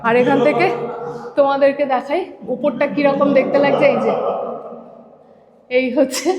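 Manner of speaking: echoing inside the empty tower; cathedral echo. Real, not acted. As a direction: voice ringing off bare walls. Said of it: "ইকো হচ্ছে পুরো".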